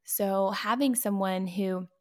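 normal talking voice

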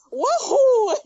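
high, loud cartoon Mario cheer with a laugh; Mario wahoo. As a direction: whoop it like Mario jumping. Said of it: "then go".

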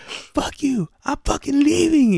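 high mock tantrum of a kid; acted-out childish outburst; imitated yelling. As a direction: squeal in a huff, imitating. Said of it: "He's fucking yelling at me".

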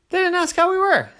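very high, loud complaint; high whiny protest; indignant kid. A narrator's reading. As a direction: squeak it out in disbelief. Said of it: "said Oren".

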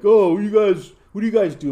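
loud retold heckle from a group of teens; imitated rowdy teens. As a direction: call it out like drunk kids. Retelling teenagers accosting him.

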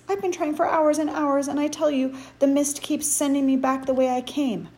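high, loud exasperated insistence; worked-up protest; frantic, frustrated. A narrator's reading. Wendell argues, frustrated at failed escape.

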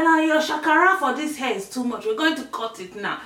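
exaggerated exasperated telling-off; scolding mum. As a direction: imitate mum handing down her verdict. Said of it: "She said".

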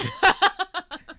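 loud, high burst of laughter; high-pitched, delighted cackle; big laugh. Real, not acted. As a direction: crack up at your own joke. Laughs at own Patreon joke.

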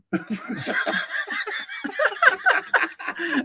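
long, very high laughing fit. Real, not acted. Co-host lost in translation, cracking up high. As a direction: break into a long high cackle.

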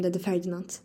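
usual speaking voice

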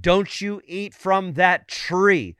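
slow, stern warning in a preacher's quote; imitating a solemn divine command; emphatic command. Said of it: "He had emphatically said it".

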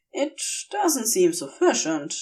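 usual speaking voice

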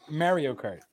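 Mario said MEH-rio, stress on first syllable; stress on wrong syllable. Shown as a demonstration. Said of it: "It's not Mario".

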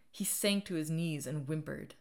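ordinary delivery

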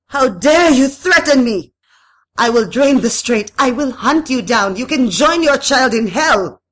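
high, loud tirade; shrieking, outraged threats; furious threats. A narrator's reading. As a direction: rage at your enemy at full voice. Madame rages at the spirit.

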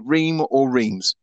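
Ream as "reem", Reims as "reemz", said slowly; anglicised guesses. Shown as a demonstration. Host puzzling over the name, slow "reem" for Ream, "reemz" for Reims. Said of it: "How do you pronounce that?".

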